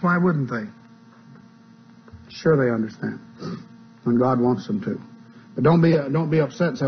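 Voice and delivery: ordinary talk, nothing marked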